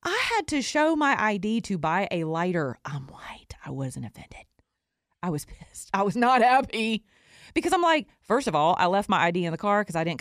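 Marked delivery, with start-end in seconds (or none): 2.8-5.9 breathy whispered confession, voice dropped away; whispered aside. Real, not acted. Host whispers a sheepish aside to her listeners, whispered.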